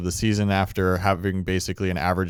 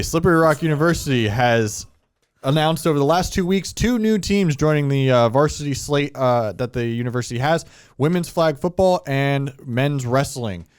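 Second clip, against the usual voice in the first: higher news anchor read; newscaster voice; 4 semitones higher than usual. Acted. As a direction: deliver it like breaking news. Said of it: "Put on your newscaster voice".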